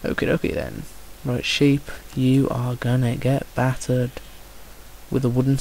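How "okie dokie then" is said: breathy whispered okie dokie; whispered aside. Real, not acted. Gamer whispering to himself before fighting sheep. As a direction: whisper it to yourself.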